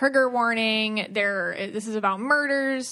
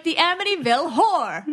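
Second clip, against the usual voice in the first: mock Long Island accent; 6 semitones higher, 3 dB louder than usual. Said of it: "You're like".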